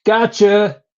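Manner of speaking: spring the prank on him; gleeful gotcha. Retold or imitated. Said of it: "And then she goes".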